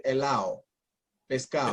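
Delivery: "helao, pescado" with the D left out; dropped D. Shown as a demonstration. Showing how Venezuelans say words, D dropped in "helao, pescado". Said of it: "so that D gets dropped".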